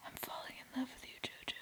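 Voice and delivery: mock his confession in a hushed, rushed whisper. Mocking Chase's confession.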